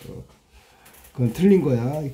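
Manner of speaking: ordinary talk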